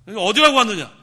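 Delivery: high, very loud scolding; booming, startling reprimand; loud rebuke. Acted. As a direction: roar it at an intruder. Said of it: "책망을 하면은 깜짝 놀라요".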